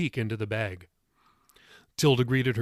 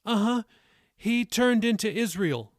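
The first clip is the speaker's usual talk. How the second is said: put-on high-pitched child answering proudly; proud boy; 10 semitones higher than usual. A narrator's reading. Jacob answers the elderly woman, proud high boy voice. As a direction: give the answer as a pleased young pupil. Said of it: "proud that he remembered the answer".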